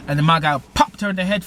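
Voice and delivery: ordinary talk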